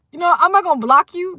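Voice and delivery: high, loud taunting call-out; taunting the chat, loud and high. Real, not acted. Taunting a viewer.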